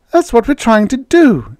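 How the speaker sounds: high, loud, exasperated retort; angry snap. A narrator's reading. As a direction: snap back at the radio. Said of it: "Judy snapped".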